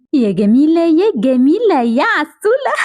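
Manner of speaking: slow, drawn-out adoring coo; cooing at baby. Acted. Parent to newborn Gamila, cooing. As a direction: coo lovingly, stretching each name.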